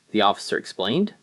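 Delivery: ordinary delivery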